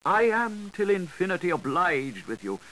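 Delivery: high, courtly and obsequious; bowing Gripes. A narrator's reading. The Gripes bowing politely to the Mookse, high and obsequious. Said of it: "bowed the gripes".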